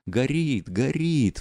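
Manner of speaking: the guests cry out as the fire catches, alarmed; crowd crying out. A narrator's reading. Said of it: "вскричали все в один голос".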